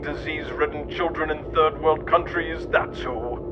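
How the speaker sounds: ordinary talk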